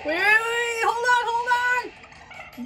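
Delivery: high, drawn-out panicked shout; panicked pleading. Acted. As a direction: beg for mercy in terror. Villain panicking and begging.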